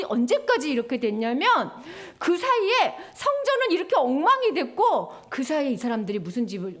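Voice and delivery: ordinary talk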